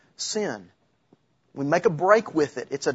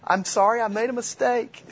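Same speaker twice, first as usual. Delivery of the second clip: sheepish mock apology; 7 semitones higher than usual. Sheepish apology to angry drivers.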